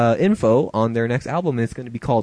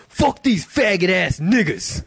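slow, higher tough street voice; swaggering street thug voice; gangster impression; 7 semitones higher, slower than usual. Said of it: "in my best gangster impression".

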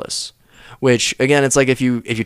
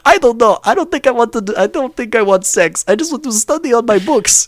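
high, louder mock-innocent student; Osama bin Laden; 13 semitones higher, 5 dB louder than usual. Sketch Osama turning down an invitation, high mock reluctance. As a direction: decline in a squeaky, wholesome kid voice. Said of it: "And he goes".

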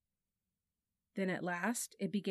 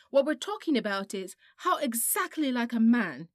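louder, mock-adult tone; grown-up voice; 4 semitones higher, 9 dB louder than usual. A narrator's reading. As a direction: lecture him like a grown woman would. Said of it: "in a very grown-up voice".

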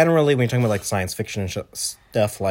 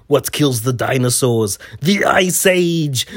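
Mr. Freeze line, booming Schwarzenegger voice, slow and higher at the end; Arnold Schwarzenegger; 3 semitones higher, 4 dB louder, slower than usual. Retold or imitated. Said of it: "at one point he goes".

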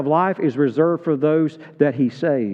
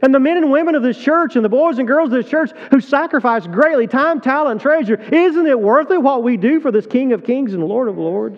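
preaching at full force; 8 semitones higher than usual. Real, not acted. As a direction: drive it home to the pews. Rallying the congregation.

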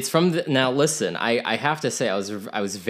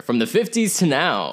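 lightly voiced radio announcer; a hint of a DJ voice; 6 semitones higher, 4 dB louder than usual. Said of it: "like, announcer".

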